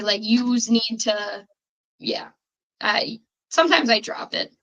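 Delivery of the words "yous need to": slow Chicago "Yous need to"; Chicago accent. Shown as a demonstration. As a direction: drawl it out Chicago style. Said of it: "and so we say".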